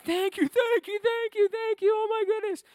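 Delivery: put-on high, squealing child's thanks, near tears; overjoyed kid. Son thanking parents for the spaceship, high squealing joy. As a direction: squeal out thanks like a thrilled little boy. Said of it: "And he was like".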